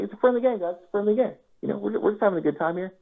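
high-pitched, breezy protest; feigned innocence. Retold or imitated. Winner soothing the beaten table, high feigned innocence. Acting innocent after winning hand.

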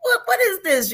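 tearing up over a movie, crying out to God; exasperated cry to the Lord. Retold or imitated. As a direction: throw it up to heaven in startled disbelief. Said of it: "I said".

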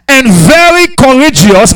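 very courageous drawn out, high and loud; emphatic scripture. Retold or imitated. Pastor voicing God's charge to Joshua, slow and emphatic. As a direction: lean hard on very courageous. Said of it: "he put it an emphasis now".

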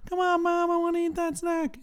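put-on high-pitched kid begging mom; ten-year-old boy. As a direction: whine up high like a small child pleading. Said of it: "ten-year-old Cruz or whatever".

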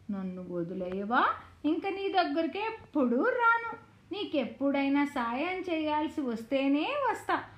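ordinary delivery, nothing marked

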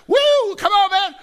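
high, loud whoop and cheer; acted-out excited shouting; excited fan yell. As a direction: whoop it up like a fan at the big game. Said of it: "we get so excited about those things".

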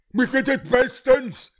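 dazed prosecutor. Acted. Caffeine-wired prosecutor assures the judge, woozy and slow. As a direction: insist you're fine while wired on coffee.

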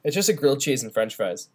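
ordinary delivery

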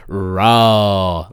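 "Raw" stretched long on one held low note; drawn-out RAW. Shown as a demonstration. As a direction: draw out the vowel of "Raw". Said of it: "Like that".

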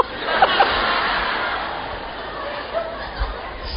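high breathy burst of laughter; real laugh at the joke. Real, not acted. Preacher laughs at own joke.

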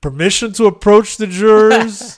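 high, loud pompous lawyer imitation; mimicking a cocky courtroom attorney; mock lawyer. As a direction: ham up a teen playing prosecutor.

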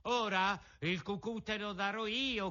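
high-pitched acted threat; shouted threat. A narrator's reading. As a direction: cry it out like the boldest urchin. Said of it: "gridò il più ardito di quei monelli".

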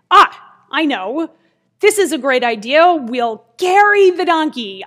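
loud, excited mock eureka; sudden bright idea. Grandmother and girl hit on a new plan, excitedly. The pair acted hitting on an idea.

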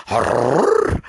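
loud, high rolling "arr"; imitated dog growl. Storyteller imitating his dog Butch, loud growling bark. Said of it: "my dog Butch was going".

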